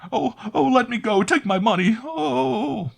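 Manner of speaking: high frantic pleading scream; panicked shrieking. A narrator's reading. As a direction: beg in terror. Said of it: "she shrieked".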